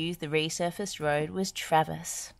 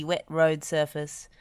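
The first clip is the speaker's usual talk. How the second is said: usual speaking voice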